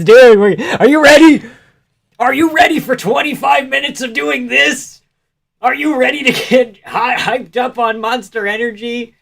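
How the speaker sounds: high, loud hammed-up hype-man shout; mock hype. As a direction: pump up the crowd like a mock ring announcer. Mock hype-man addressing the chat.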